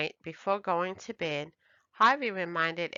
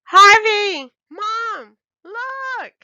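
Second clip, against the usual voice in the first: very high, loud calling out; little girl thrilled, calling her family; squealing child voice; excited shout; 11 dB louder, slower than usual. A narrator's reading.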